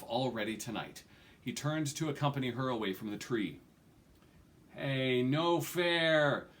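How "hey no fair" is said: rowdy youth shouting no fair; indignant ruffian. Acted. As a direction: call out like a sulky thug. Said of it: "a voice exclaimed".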